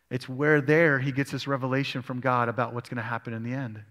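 normal talking voice

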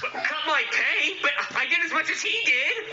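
high-pitched, whining objection; upset protest. Acted. Officer protests the boss cutting his pay, upset. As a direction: plead high against the unfairness.

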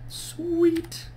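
sudden high squeaky sweet; delighted high-pitched sweet; excited squeal. Real, not acted. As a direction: squeal it out, pleased. Pleased with her progress.

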